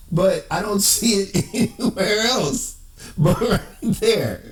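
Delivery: high, laughing through the words; trying not to laugh. Real, not acted. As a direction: talk while holding back laughter.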